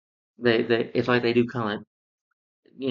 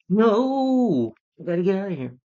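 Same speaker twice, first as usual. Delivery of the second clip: loud, high, drawn-out "no" in mockery; Matthew Broderick; 10 semitones higher, 5 dB louder than usual. He mocks the film actor's Gadget, exaggerated high wail. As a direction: wail it in an exaggerated, hammed-up imitation. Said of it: "the way Matthew Broderick did it was like".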